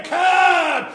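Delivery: "Come" called out high and loud; shouted come. Acted. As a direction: call "Come" across the church. Jesus calling out, shouted.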